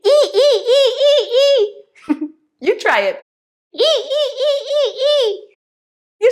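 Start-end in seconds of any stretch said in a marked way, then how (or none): 0.1-2.3 high repeated "ee" dolphin calls; dolphin squeaks. Shown as a demonstration. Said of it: "make the sound of the dolphin". 3.7-5.5 very high, short repeated ee squeaks; dolphin squeaks. Shown as a demonstration. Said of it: "make the sound of the dolphin".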